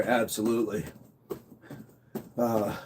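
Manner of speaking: normal talking voice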